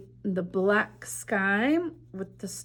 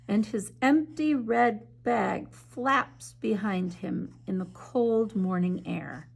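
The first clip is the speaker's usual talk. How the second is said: story narration. A narrator's reading. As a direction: read it like a bedtime story to children. Reads picture book aloud.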